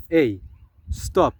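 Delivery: loud, sharp command to stop; firm warning. Acted. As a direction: call a halt, loud and firm. Acted warning to someone persisting.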